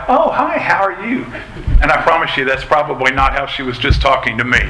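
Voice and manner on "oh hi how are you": high, sugary phone greeting; imitating wife's cheery phone hello; mock polite phone voice; sweet phone voice. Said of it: "she talks to you on the phone like".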